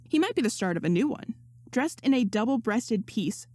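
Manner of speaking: ordinary delivery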